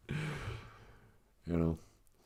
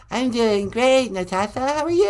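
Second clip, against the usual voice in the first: [name]; 11 semitones higher than usual. Acted. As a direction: coo high like the pop star. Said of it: "It's me, [name]".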